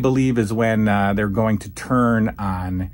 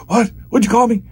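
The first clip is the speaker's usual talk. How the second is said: loud, much higher mock outrage; offended Orndorff; 12 semitones higher, 7 dB louder, faster than usual. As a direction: bristle like a tough guy insulted. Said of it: "And Orndorff is like".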